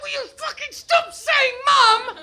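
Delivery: high loud fed-up shout with a laugh; mother at the end of her tether, laughing yell; exasperated yell. Retold or imitated. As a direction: snap at the kids to stop. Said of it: "I'm like".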